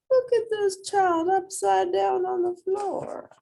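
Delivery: high, squeaky exclamation; high-pitched amazement at a picture; exclaiming. A narrator's reading. Reader voices reaction to picture.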